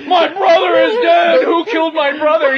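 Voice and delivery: high, loud mock-grief shout; anguished wail. Giant discovering his dead brother, wailing in mock anguish. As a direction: cry out in hammed-up outrage.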